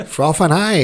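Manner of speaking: a hint of Aussie surfer slang voice; Sal Fitzgibbons surfer voice. Shown as a demonstration. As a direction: play the stoked Aussie surfer on frothing. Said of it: "Sound like Sal Fitzgibbons".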